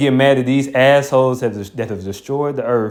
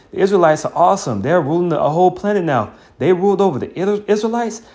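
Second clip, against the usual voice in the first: higher, incredulous onlooker voice; mock disbelief; 5 semitones higher than usual. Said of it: "They're like".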